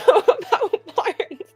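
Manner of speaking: ordinary delivery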